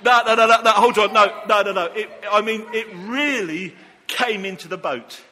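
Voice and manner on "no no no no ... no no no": loud, insistent string of protests; urgent, raised-voice interruption; calling a halt. Real, not acted. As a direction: call out over the commotion to stop them. Stopping the helpers mid-stunt.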